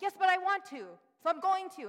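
high, loud, stubborn whine; arguing with the Holy Spirit, petulant; childish defiance. Retold or imitated.

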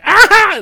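high, loud mock scream. Host imitates the screaming character, high mock scream. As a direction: let out a hammed-up high yell.

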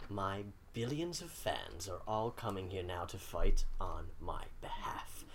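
low, hushed, slow menace; quiet villain threat. Acted. Villain Michael warning that his fans are coming, low and hushed. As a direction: drop to a near whisper, slow and threatening.

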